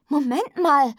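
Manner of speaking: high, loud protest; crying out. A narrator's reading. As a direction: call out urgently to stop someone. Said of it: "rief ich".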